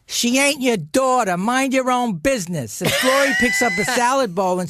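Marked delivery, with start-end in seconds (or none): 0.1-2.8 higher, sharp retort as the angry mother; a mother telling her husband off at dinner; mother snapping back. Retold or imitated. Mother scolds stepfather at dinner, sharp and higher. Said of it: "and she goes".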